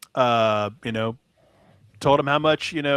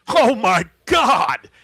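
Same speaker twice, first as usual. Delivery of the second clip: loud, high, drawn-out mock amazement; stunned exclamation; 8 semitones higher, 8 dB louder, much slower than usual. Vendor stunned by a huge tip, loud imitated amazement. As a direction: imitate a floored vendor, stretching each word.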